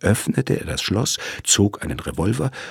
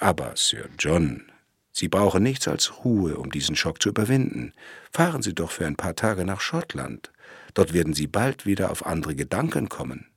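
usual speaking voice

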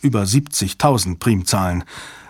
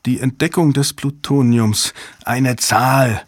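ordinary delivery

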